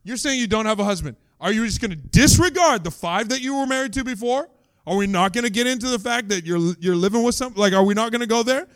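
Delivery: mock angry interrogation; angry accusation. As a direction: grill her like a suspicious accuser. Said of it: "an angrier person could be like".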